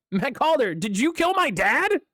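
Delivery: normal talking voice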